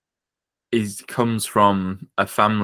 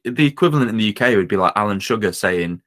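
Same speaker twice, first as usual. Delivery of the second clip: ordinary delivery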